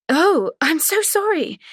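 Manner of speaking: flustered guest walking in on the couple. Acted. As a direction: caught off guard, blurt it out. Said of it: "the first time Tess had ever seen her flustered".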